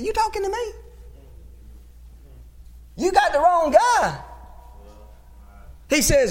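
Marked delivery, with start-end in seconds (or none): 0.0-4.2 high-pitched, disbelieving little voice; incredulous Gideon. Acted. Preacher as Gideon doubting God's call, incredulous and high. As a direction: act baffled at being picked, voice pitched up. Gideon answering God, comic retelling.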